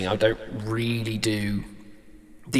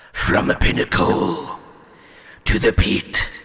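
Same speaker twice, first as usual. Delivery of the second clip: 1 gritty villain whisper; 2 semitones lower, 4 dB louder than usual. Shown as a demonstration. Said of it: "more in character".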